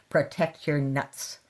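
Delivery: ordinary delivery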